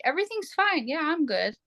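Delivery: high, sing-song pretend cheer; fake all's-well. Couple hiding built-up resentment from each other, imitating fake okay. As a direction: pitch it up like you're hiding resentment. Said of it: "we would both act like".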